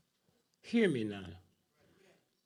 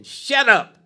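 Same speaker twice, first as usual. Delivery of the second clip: louder, higher, a harsh shout of shut up; loud dismissive shout; 9 semitones higher, 18 dB louder than usual. Retold or imitated. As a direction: yell it down at the protesters. They keep telling protesters.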